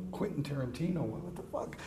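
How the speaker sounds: retold under-the-breath disbelief; hushed, trailing-off mock bewilderment; baffled aside. Retelling his baffled reply to Tarantino.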